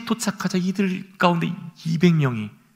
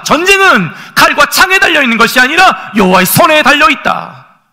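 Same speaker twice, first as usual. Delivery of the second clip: ringing proclamation, raised voice; proclaiming; 6 semitones higher, 4 dB louder than usual. Retold or imitated. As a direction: call it out like a battle cry. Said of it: "외칩니다". (선포하듯 외치며)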